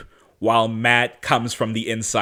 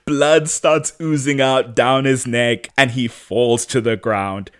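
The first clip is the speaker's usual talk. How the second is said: ordinary talk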